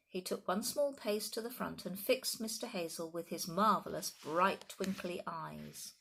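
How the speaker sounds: plain reading voice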